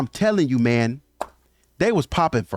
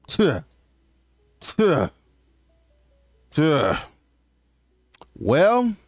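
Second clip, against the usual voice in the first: stalling hesitation; much slower than usual. Retold or imitated. Imitates suitor stalling before answering.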